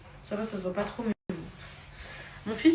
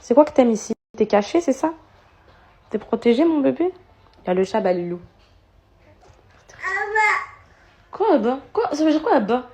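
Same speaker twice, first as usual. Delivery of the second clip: higher, loud teasing coo; 4 semitones higher, 8 dB louder than usual. Real, not acted. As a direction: tease the little one on the chair. Loud baby talk to son. (maman taquine son fils d'une voix forte et aiguë)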